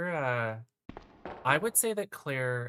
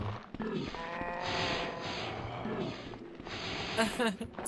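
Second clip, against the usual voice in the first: long higher sinister laugh; evil villain laugh; 6 semitones higher than usual. Retold or imitated. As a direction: cackle like a creepy villain. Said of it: "She sounds evil".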